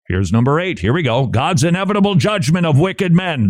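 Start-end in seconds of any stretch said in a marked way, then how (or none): 1.3-3.5 high-pitched preacher's cry, building to a shout; hammed-up fire-and-brimstone proclamation; mock hellfire preaching. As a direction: thunder the judgment, climbing higher to the end. Showcasing the one hellfire sermon title.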